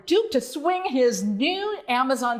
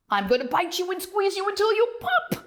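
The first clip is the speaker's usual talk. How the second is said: higher, fast hissing threat; menacing snake; 7 semitones higher, faster than usual. Acted. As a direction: sneer it like a hungry snake. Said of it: "said the snake".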